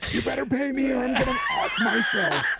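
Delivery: high-pitched hammed-up yell at a client; mock yelling. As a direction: yell it like an unhinged mock threat. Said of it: "yelling shit at your clients".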